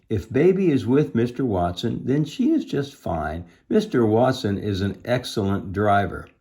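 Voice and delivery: lively dialogue with leaping pitch; animated story reading. A narrator's reading. Missus Watson soothing Eugenia, animated storytelling. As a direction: contrast reassurance with indignant retort.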